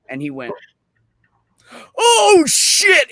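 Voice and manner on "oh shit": loud, high shout of realization; stunned outburst. Retold or imitated. Coworker finally gets the dick joke, astonished outburst. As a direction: burst out as the joke lands.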